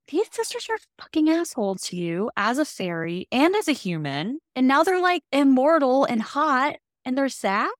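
fed-up reader ranting, pitched high; exasperated rant. Retold or imitated. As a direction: vent at the sisters, fed up. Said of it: "the whole time I'm like".

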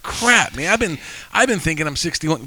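high, incredulous crap man; exasperated disbelief. Retold or imitated. Host retelling realizing he is sixty-two, incredulous. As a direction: throw your voice up in disbelief. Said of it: "I was just like".

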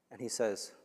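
ordinary delivery

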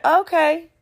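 high, easygoing voice; imitated casual man. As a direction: imitate him agreeing, pitched well up. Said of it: "He's like".

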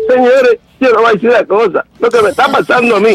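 high-pitched, hammed-up wailing to camera; mock tearful plea. Caller parodies a tearful video to viewers, mock tearful plea. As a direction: plead as if crying for an audience. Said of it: "déjame prender la cámara para ponerme a grabar".

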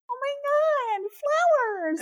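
put-on childlike delight. A little girl thrilled by flowers, high squeal. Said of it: "I'd be like".

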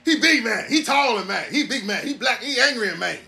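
singsong high-pitched put-on voice. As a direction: imitate a guy gawking and teasing.

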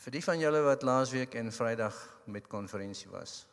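normal talking voice

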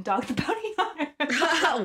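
high, slow delivery breaking into laughter; laughing punchline. Real, not acted. Revealing who paid the actor's bond, laughing. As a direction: crack up on the reveal.

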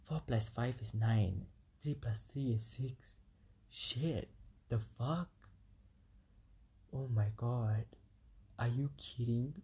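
hushed, breathless disbelief; stunned murmur to herself; shocked whisper. Retold or imitated. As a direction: whisper it as the penny drops. Realizing the lyric's meaning.

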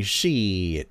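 drawn-out, falling one-word exclamation; mock slang drawl. Reading out a viewer's comment, mock slang drawl. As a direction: stretch the word out in imitation slang. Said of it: "Nobody spoke like that".

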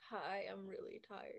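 low, soft, worn-out hi; sleepy mumble; tired voice. Shown as a demonstration. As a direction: drag it out like you're exhausted. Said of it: "My voice goes from loud, squeaky to".

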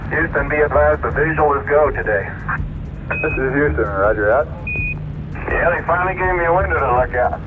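pilot over the radio. Real, not acted. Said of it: "That last voice you heard was [name]".

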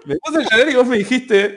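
laughing while telling. Real, not acted.

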